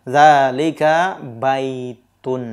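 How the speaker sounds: zalika baitun said very slowly, each syllable clear; teacher modelling the right answer, very slow; slow model answer. Shown as a demonstration. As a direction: spell the answer out slowly for the class. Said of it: "jawabannya apa yang tepat".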